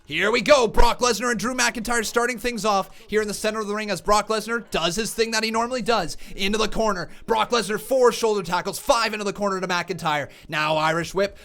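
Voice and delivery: live play-by-play call; wrestling commentator. Acted. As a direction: call the action ringside. Streamer calls the match himself.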